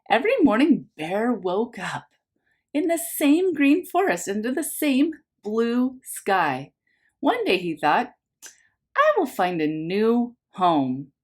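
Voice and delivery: lively picture-book narration for a class; reading to children. A narrator's reading. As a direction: read it like story time for little ones. Teacher reads picture book aloud.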